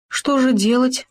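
plain reading voice

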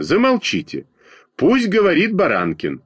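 high-pitched command to a noisy class; lightly acted bossy call; calling for silence. A narrator's reading. Girl calls noisy class to order.

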